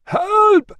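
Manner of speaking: very high, loud yell; cry for help. A narrator's reading. Catavignus yelling from the burning malt house. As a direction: shout as if through a door. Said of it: "came Catavignus's voice again".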